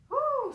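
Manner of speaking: high loud whoop; excited cheer. Real, not acted. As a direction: let out a big woo. Cheer after finishing the set.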